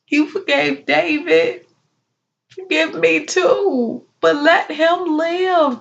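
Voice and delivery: high, anguished wail to God; distraught mother crying out in prayer; desperate pleading. Acted. Mother begs God for baby's life.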